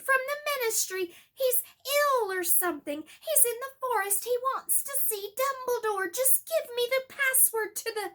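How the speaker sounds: high, loud pleading shout; desperate shout. Acted. Harry shouting at Snape, desperate and loud. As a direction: fire it all out in a rush.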